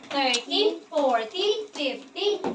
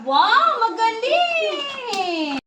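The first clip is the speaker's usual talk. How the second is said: higher and louder wow, then a slow stretched "magaling!"; big delighted classroom praise; excited praise; 6 semitones higher, 3 dB louder, much slower than usual. Real, not acted. Teacher cheering a pupil's answer.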